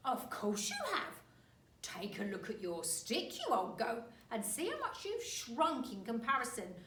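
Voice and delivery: put-on slow, loud, gloating old man; horrible grin. Husband taunts wife.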